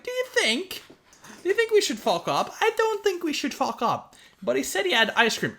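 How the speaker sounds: put-on a timid child tempted by ice cream; little girl. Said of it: "the girl's like".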